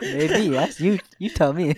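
teasing the friend, laughing loudly; loud laughing tease. Real, not acted. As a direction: echo it back loud with a laugh.

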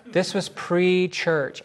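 slow, drawn-out mock objection; imagined objector. Imagined listener quibbles over the word church, mock objecting. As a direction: lightly mimic a quibbling listener. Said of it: "I can hear somebody going".

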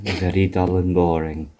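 low, quiet dull drone; boring voice. Pretend. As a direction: drone it like a bore. Imitating the boring voice.

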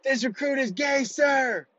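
high, shouted military bark; barked sir yes sir. Retold or imitated. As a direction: bark it out like a recruit at attention. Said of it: "And he goes".

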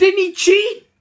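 loud, high, outraged question; mock indignant. Real, not acted. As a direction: squeal it out in disbelief.

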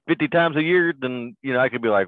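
ordinary delivery

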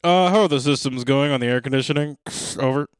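loud mission control radio call; breaking up space radio. Pretend. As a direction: bark it like crackly capsule radio. Mock space mission radio chatter.

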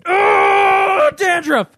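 a high cry of disgust breaking into a shout; sudden yell. Real, not acted. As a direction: yelp as the dandruff comes out. Scratching dandruff with a pen.

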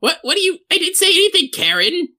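very high, stammering protest; baffled hag Patricia. Acted. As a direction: protest your innocence in a high-pitched voice. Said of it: "Patricia looks back at her and says".

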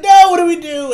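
high, loud imitated panicked cry; mock panic. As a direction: wail it as the helpless onlookers.

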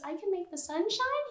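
a hint of a wide-eyed child; little baby voice. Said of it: "in her little baby voice goes".

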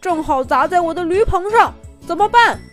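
panicked cry. Acted. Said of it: "惊叫了一声".

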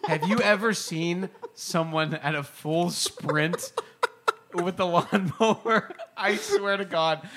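slow, laughing through the words; can barely get it out for laughing. Real, not acted.

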